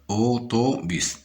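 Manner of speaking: AU-TO-BUS in three slow beats; split into syllables. Shown as a demonstration. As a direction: sound out Autobus syllable by syllable. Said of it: "dans la deuxième syllabe".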